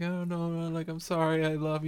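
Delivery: high, put-on begging voice; mock pleading. Girlfriend saying sorry as he leaves, high mock pleading. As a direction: beg like a desperate partner, imitated. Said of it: "pleading with him".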